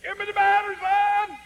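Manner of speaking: piercing, high-pitched full-volume yell; yelling old lady. Retold or imitated. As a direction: holler it high at the top of an old lady's voice. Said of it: "she yelled and said".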